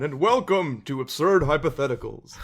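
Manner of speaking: booming jolly Santa host; hearty, loud Father Christmas greeting. Acted. Host opens show as Santa.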